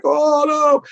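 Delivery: high-pitched mock cry of dismay; mock wailing. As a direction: imitate a panicked, tearful outcry. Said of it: "screaming and crying like".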